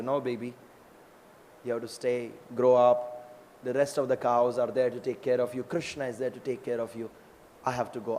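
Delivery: soft, low motherly reassurance; gently acted farewell to a child, hushed and low; soothing mother. Mother cow soothes her calf.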